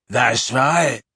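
a hint of a rasping bark; rough, barking rasp. A narrator's reading. Werewolf answering Dumbledore with a light rasp. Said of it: "rasped the other".

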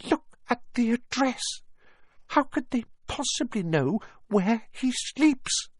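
very high, halting, frightened woman; quivering voice. A narrator's reading. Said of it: "saying in a quivering voice".

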